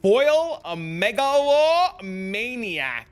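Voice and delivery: slow, high, loud drawn-out proclamation; dramatic announcement. Acted. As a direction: stretch every syllable like a grand reveal. Announcing the last item he made.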